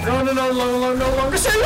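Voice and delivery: loud, high string of panic; panicked yelling. Real, not acted. Gamer no longer safe in game, panicked shouting. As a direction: yell it as danger closes in.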